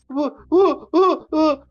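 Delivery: high hooting "woo-woo" mimicking her upset; short, high-pitched mock sobs; mock wailing. Imitating her reaction to being thrown out, mock wailing.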